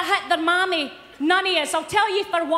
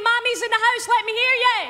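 higher, raised stage holler; rousing crowd call; 5 semitones higher, faster than usual. Real, not acted. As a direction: call out to get the audience cheering. Calling on audience to cheer.

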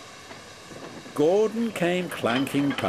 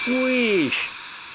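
high, drawn-out steam whistle imitation; imitated engine whistle, high and sustained; sudden "wheesh"; 13 semitones higher than usual. A narrator's reading. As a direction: burst out with a long piped "wheesh". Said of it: "said Percy suddenly".

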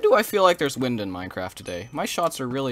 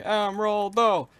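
excited; 9 semitones higher, 4 dB louder, slower than usual. Real, not acted. Gets the emerald bow.

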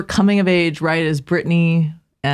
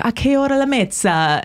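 Italian spoken with a lilting tune, pitched higher; Italian accent; 6 semitones higher than usual. Retold or imitated. Tourist asking an Italian lady the time, in a light Italian accent. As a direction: ask the time like a native Italian. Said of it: "I'm better at the Italian too".

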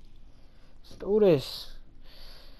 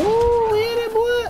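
excited; 7 dB louder than usual. Real, not acted. Landing a hit in game.

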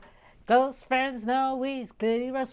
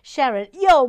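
loud call across the room; 3 semitones higher, 14 dB louder than usual. Real, not acted. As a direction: shout her name. Shouts to Sharon.

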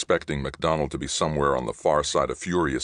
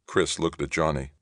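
plain reading voice

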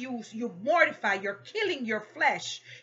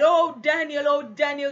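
loud "Oh", then easing back; mock worried shout; retold anxious call; 2 semitones higher, 6 dB louder than usual. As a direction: call out as the scared king. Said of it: "'cause he was scared".

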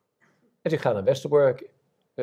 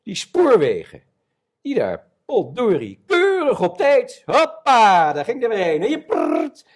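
higher, louder, slowed sarcastic outrage; retold fury; 9 semitones higher, 5 dB louder than usual. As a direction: spit it out, mocking the punctual trains. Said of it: "En ik werd zo kwaad". (spottende woede over de treinen, hoger en luider)

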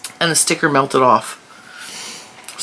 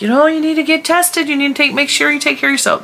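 fussing viewers; 11 semitones higher than usual. Retold or imitated. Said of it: "everybody was like".

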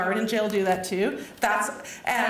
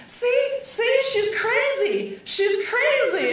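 mocking taunt; 13 semitones higher, 4 dB louder, much slower than usual. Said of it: "there's a few guys waiting for him".

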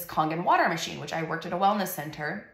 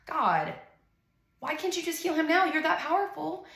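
much higher, anguished plea; pleading with God; 10 semitones higher than usual. Retold or imitated. Mother pleads with God to heal her son now, higher and anguished. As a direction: cry out to heaven in frustration. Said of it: "And I'm like".

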